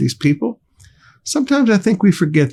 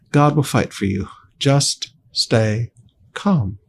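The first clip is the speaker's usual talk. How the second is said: calm reassurance; 4 semitones lower, slower than usual. Acted. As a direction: say it gently to settle them. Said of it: "So he calms them down".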